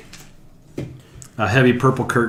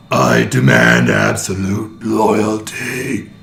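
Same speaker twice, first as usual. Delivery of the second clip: slow booming alien voice; 6 dB louder, much slower than usual. Acted. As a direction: boom it out like an otherworldly overlord. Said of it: "that loud alien voice you heard before booms out".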